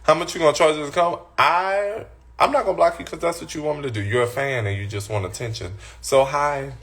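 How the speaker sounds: ordinary delivery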